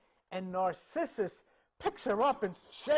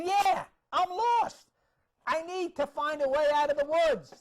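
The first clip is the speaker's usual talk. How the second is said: yelling out; 9 semitones higher, 6 dB louder than usual. Acted. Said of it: "he's yelling out".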